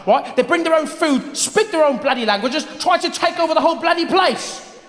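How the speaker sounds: put-on British accent climbing into a high rant; hammed-up British outrage, building to a peak; British bigot rant. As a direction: justify yourself indignantly as a Brit. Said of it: "Well, that sounds British to me".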